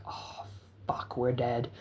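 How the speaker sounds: soft whispered panic; scared whisper. Retold or imitated. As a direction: breathe it like you've just been caught. Caught by the cop.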